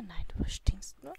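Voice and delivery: unvoiced whispered tease; whispered joke. Real, not acted. Player whispering a joke about the stinky Sim. Joking aside.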